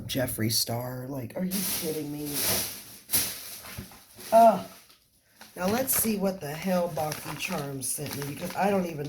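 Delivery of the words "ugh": loud, higher groan of annoyance; loud exasperated groan; loud frustrated ugh. Real, not acted. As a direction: let out a loud fed-up ugh. Annoyed at a wrong box.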